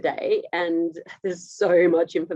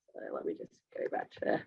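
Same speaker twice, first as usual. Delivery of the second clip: muttered under breath; 13 dB quieter, faster than usual. Real, not acted. Fixing stuck slides, muttering aside.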